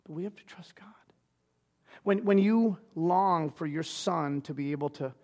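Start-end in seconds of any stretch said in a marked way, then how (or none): 0.0-0.9 soft whisper; hushed whisper to grieving listeners. Real, not acted. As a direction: breathe it as a secret comfort. Tender sermon moment.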